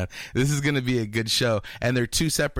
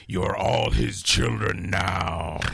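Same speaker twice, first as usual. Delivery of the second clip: creepy villain voice; 3 semitones lower, much slower than usual. Retold or imitated. Quoting Freddy Krueger.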